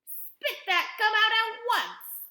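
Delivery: high, loud, slow command; alarmed father's shouted order. A narrator's reading. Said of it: "ordered Mister Beauregard".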